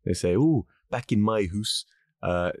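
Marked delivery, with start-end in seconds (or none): 0.3-1.8 put-on slow, drawn-out Scottish accent. Host imitating Scottish people, slow Scottish accent. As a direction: put on a Scot saying "hoose". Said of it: "that's how Scottish people say house".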